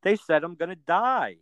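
imitating dad's frightened disbelief; wide-eyed alarm. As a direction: mimic his stunned wide-eyed announcement. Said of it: "this is exactly how he said it".

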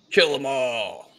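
slow, high, drawn-out command to kill; villain's battle cry. Retold or imitated. Shang Tsung ordering his posse to attack, slow high command. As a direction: bellow it like a sorcerer leading his posse. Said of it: "and he's like".

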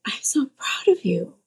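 whispered, tender; whispered pride. Retold or imitated. Mom telling daughter she's proud, whispered. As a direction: breathe it out as a whisper. Mom's tearful change of heart.

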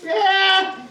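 a sarcastic high yeah; mock sarcastic yeah. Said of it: "I'm like".

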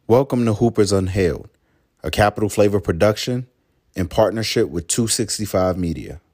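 loud, punchy show intro; announcer voice. Acted. Podcast intro announcer read.

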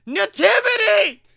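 yelling "Nativity!" with its exclamation point; yelled title. Shown as a demonstration. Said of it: "that's why I had to yell it".

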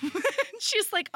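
ordinary delivery

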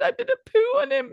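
very high giggling voice; laughing. Real, not acted. Telling the co-host, very high giggling voice. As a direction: squeak it out laughing.

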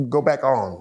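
ordinary talk

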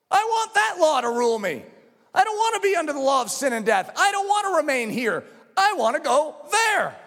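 high, fervent declaration; forceful pulpit cry; impassioned preaching. Real, not acted. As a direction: declare it with full conviction.

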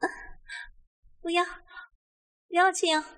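high, gasping feminine plea; protesting woman. A narrator's reading. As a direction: plead haltingly in a high voice, with pauses. Woman protests and pushes him away.

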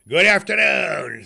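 loud, high, curt goodbye; curt dismissal. Acted. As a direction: slam the door with your voice. Scrooge dismissing again.

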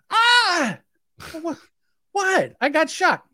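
high yelping cry then confused whats; retold shocked yell. Helper zapped again, a high retold yelp of pain. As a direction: yelp in pain, then ask what happened.

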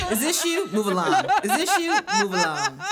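high, laughing bouncer voice. Acted. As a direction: wave them off at the door, laughing. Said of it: "[name] as a bouncer".

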